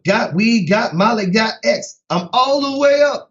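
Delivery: high, half-chanted rapper impression. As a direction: chant the list up high like a rapper hawking his wares. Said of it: "He sound like, like [name]".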